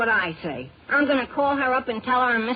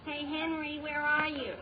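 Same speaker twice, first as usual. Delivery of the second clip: distant, higher-pitched call, soft in level; far-off boyish hail; 3 semitones higher, 9 dB quieter than usual. Acted. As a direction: call for a friend from another room. Friend calls from outside the room.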